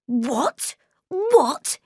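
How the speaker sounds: sharp rising then high falling what; boy stunned at being insulted, high outrage; outraged disbelief. A narrator's reading. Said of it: "he cried".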